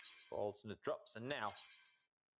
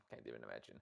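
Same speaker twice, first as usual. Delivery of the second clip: "Can't even imagine" dropped much lower and softer; mock deep mutter; 8 dB quieter, much faster than usual. Teasing the older caster in a deep, soft mock mutter.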